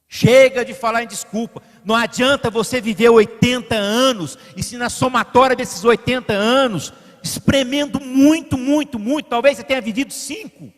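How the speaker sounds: normal talking voice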